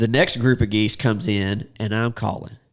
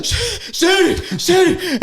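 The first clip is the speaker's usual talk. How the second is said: breathless shout; much slower than usual. Retold or imitated. Urging the shooters as the goose lands, breathless shout. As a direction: yell at them to shoot, out of air. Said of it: "I've got no air".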